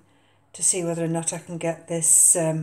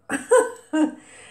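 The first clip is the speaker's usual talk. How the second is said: short, loud, high laugh; bright burst of laughter; self-amused laugh; 10 semitones higher, 8 dB louder than usual. Real, not acted. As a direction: laugh at your own botched attempt. Laughs at own attempted pronunciation.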